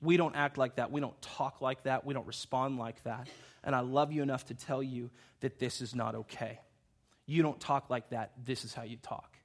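low, softened, firm talking-to; respectful, loving correction. Retold or imitated. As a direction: take the boy aside and correct him lovingly. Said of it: "brought him aside respectfully and lovingly".

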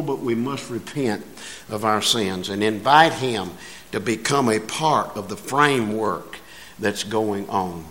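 ordinary talk

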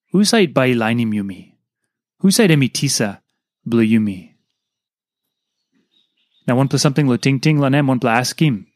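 ordinary talk, nothing marked

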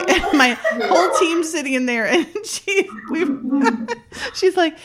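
laughing too hard to get it out. Real, not acted. Laughing while telling meeting story.

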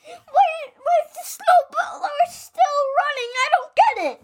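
a flustered grandpa, confused; baffled grandpa. Acted.

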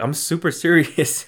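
high-pitched, playfully emphatic; playful insistence. Retold or imitated. Telling best friend about the press badge, playfully insistent. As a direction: re-enact insisting, pitched well up.

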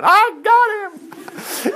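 very high, slow drawn-out triumphant yell; screaming. Retold or imitated. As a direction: imitate her shrieking win. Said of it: "She was screaming".

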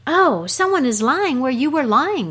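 peeking dog who can't believe his eyes; high astonished little voice; surprised dog. Acted. Dog peeks, sees the man.

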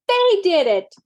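high accusing cry. A narrator's reading. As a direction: point and cry out, pitched high. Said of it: "cried the twins".

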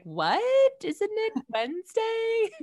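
puzzled listener, leaping high on the question; mock confusion. Host voices listeners thinking it's the wrong day, mock confused. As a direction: draw out a baffled question, soaring upward. Imagined listener reacting to Friday.

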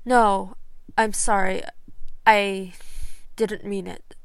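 a loud, hurried plea; loud protest. Acted. Severus to Doctor Peoples, a loud panicked apology. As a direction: blurt it out to stop her.

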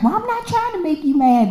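high, defensive coworker voice; mild mock of a defensive coworker; coworker's reply. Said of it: "she's like".

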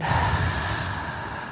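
slow audible breath out; long exhale sigh. Shown as a demonstration. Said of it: "let it all out".

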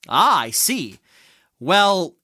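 usual speaking voice

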